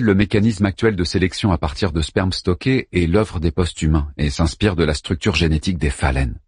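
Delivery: usual speaking voice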